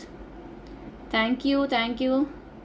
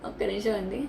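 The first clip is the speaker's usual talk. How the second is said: softly, keeping her voice down; quietly asking for a minute; hushed; 3 semitones lower, 6 dB quieter than usual. Real, not acted. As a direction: drop to a hush for a moment. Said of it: "అమ్మో, నేను గట్టిగా మాట్లాడకూడదు".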